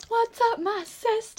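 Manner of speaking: high, shouted beauty-guru greeting; drunk James Charles voice. Retold or imitated. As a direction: yell it like a tipsy vlogger. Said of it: "picture you drunk saying".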